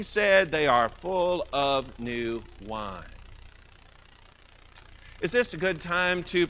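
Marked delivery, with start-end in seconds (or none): 0.5-3.0 low, slow scornful jeer; mocking. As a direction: drag it out low, deriding them.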